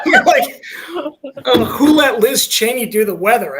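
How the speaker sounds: laughing through the joke. Real, not acted. Joking to the host about the drag weatherman, laughing. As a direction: crack up while delivering the punchline.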